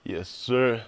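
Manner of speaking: ordinary talk, nothing marked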